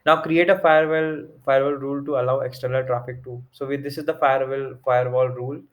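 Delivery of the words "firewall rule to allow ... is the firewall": ordinary talk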